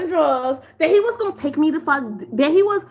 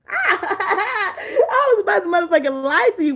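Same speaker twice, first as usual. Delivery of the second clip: laughing through it, a little slower; 5 semitones higher, slower than usual. Real, not acted. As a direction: crack up as you admit it. Drunk confession, bursts out laughing.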